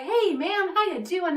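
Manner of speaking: high, bouncy mock greeting; hammed-up cheery hello, pitched high; giggly gate guard. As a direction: imitate a super goofy guard greeting. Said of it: "singing, and he had music playing".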